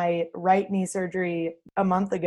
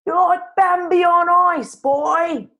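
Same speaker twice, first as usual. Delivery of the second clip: high, loud hammed-up film line; exaggerated high-pitched film impression; movie quote; 9 semitones higher, 6 dB louder than usual. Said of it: "to quote a classic film, She's the Man".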